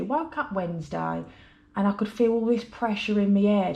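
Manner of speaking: ordinary talk, nothing marked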